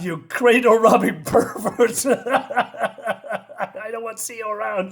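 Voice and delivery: acted scolding that breaks into real laughter; laughing through insult. Real, not acted. Reader cracks up while voicing insult.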